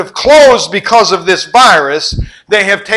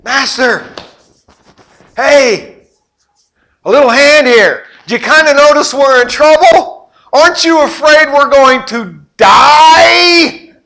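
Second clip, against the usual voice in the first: higher, louder, rising to a frightened cry; panicked Peter; 7 semitones higher, 6 dB louder than usual. Retold or imitated. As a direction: plead with the sleeping master, scared to die. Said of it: "Peter goes over and".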